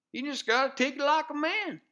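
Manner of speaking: ordinary talk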